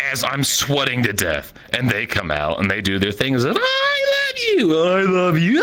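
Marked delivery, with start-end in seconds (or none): 3.6-5.6 mock lovey-dovey vows. Mocking the couple's vows.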